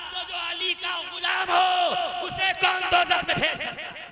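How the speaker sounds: loud, high, impassioned sermon shout; fiery preaching. Acted. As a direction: preach it to the crowd at full force. Sermon rising to full force. (जोशीला ऊँची आवाज़ में was)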